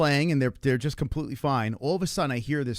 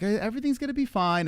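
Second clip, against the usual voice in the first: high, lightly voiced doctor; flamboyant doctor; 8 semitones higher than usual. Doctor reassuring worried parents, lightly flamboyant and high. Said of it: "little flamboyant".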